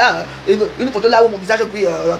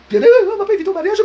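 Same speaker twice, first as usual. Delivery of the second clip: high laugh through the words; 9 semitones higher, 4 dB louder than usual. Real, not acted. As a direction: laugh it out, pitched up. Mocking jokes about her critics. (en riant, voix aiguë)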